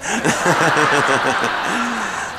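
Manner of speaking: long rolling laugh; hearty laugh. Real, not acted. Storyteller after his terrible twenties joke, long laugh. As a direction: laugh heartily at your own punchline.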